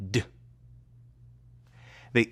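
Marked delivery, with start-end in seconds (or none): 0.1-0.2 "D" as a short voiced consonant. Shown as a demonstration. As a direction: make only the "D" sound, with voice. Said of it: "You use your voice to say".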